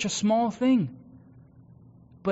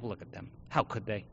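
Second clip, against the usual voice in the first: much softer, private inner grumble; muttered inner judgment; 10 semitones lower, 13 dB quieter than usual. Retold or imitated. Pastor voicing his annoyed private thoughts about a coworker, hushed. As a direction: grumble it under your breath. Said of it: "I think to myself".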